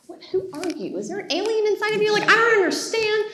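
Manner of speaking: high, fast, baffled; incredulous rising questions; shocked disbelief. Retold or imitated. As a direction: act stunned that he agreed. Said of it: "I was like".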